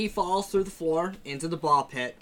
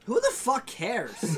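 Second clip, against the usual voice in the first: raised, slow exclamation; exasperated outburst; 9 semitones higher, slower than usual. Real, not acted. Cutting off friends arguing over movie dates, exasperated. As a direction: burst in, fed up.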